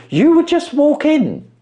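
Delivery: brightly coaxing his employer to apply; mock eager urging. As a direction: sell it with a lift at the end.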